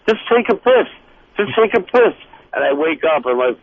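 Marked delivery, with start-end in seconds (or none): 0.1-2.2 high, loud dream command. Retold or imitated. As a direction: urge him like a voice in a dream. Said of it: "this guy standing next to me saying".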